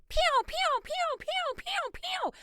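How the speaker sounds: high-pitched laser-gun pew sounds; pew pew noises. Pretend. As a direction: fire off toy blaster noises. Sound effect for scandal shots.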